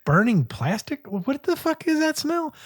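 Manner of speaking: sniffing out something wrong in alarm; alarmed disbelief. Retold or imitated. Retelling smelling smoke from the oven, alarmed and startled. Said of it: "And I'm like".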